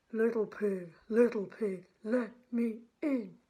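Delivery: wolf demanding to be let in; wolf calling. Acted. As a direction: boom it at the door. Wolf calls at door.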